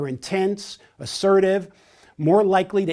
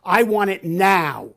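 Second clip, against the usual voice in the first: louder, impatient demand; 3 semitones higher, 6 dB louder than usual. Shown as a demonstration. Trainer voices a direct person demanding results, loud and impatient. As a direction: insist on it right now. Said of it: "emphasize points through confident vocal intonation".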